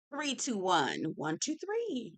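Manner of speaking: a mock self-soothing countdown, pitch swinging on each number; calming count. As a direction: breathe and count one two three to stay calm. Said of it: "I had to do my one, two".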